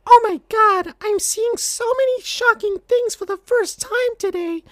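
put-on very high baby voice; high-pitched baby cartoon voice; Knuckles Junior. A narrator's reading. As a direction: squeak it out like a tiny cartoon baby. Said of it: "said Knuckles Junior".

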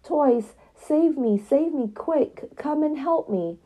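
high, urgent cries for help; a doll pinned by the cat, yelping high for the toys. Acted. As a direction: call out to the toys high and desperate. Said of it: "Amelia yelped for help".

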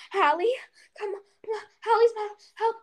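high, frantic cry for help; panicked plea. Retold or imitated. Panicked call for help over lost iPad.

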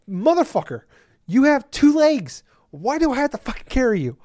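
half-yell it in mock fury; exasperated. Gamer venting at Mama for demanding a carry, mock outraged. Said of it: "I'm like".

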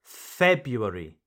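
February said FEB-yoo-air-ee; first R dropped. Shown as a demonstration. Teacher models pronunciation number two, February without its first R. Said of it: "Here you do not pronounce the first R".

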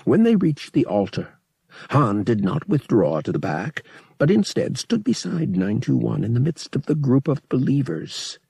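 plain reading voice

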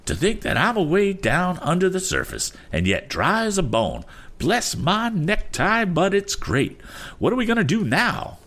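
very high-pitched, marveling oddball; excited eccentric. Acted. Voices the excited Damon.

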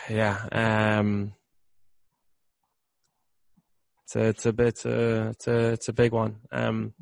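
soft, halting, taking it in; subdued. Real, not acted. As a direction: hesitate quietly as it sinks in. Shaken by the realisation, halting.

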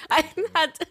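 normal talking voice

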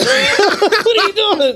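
high, slow, laughing question; incredulous giggle at the driver; laughing disbelief. Real, not acted.

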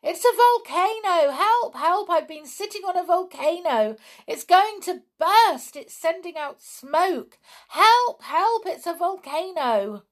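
high, loud panicked screams for help; screaming in panic. A narrator's reading. Girl fleeing down the hill, screaming in panic. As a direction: shriek for help as you flee. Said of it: "screaming loudly".

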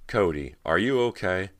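loud, urgent check-in; concerned call. A narrator's reading. Rob calls to Cody across the goo, loud concern. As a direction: call across to her, pitch lifted.

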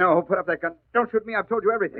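high, pleading cry of a man at gunpoint; a cornered crook begging the Hornet not to shoot; terrified pleading. Acted. Baker begging not to be shot.

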